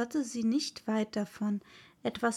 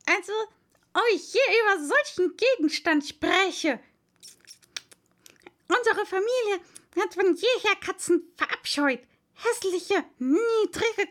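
high, loud squeaky tirade; a small creature quivering with rage; shrill mouse voice spitting disgust; trembling, indignant mouse; 7 semitones higher, 5 dB louder than usual. Acted. The mouse raging about cats.

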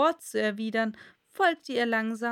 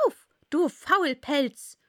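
loud, slow, scolding queen; barking queen; 4 semitones higher, 6 dB louder, slower than usual. A narrator's reading. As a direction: rouse the sleeper sharply. Queen shouting in story.

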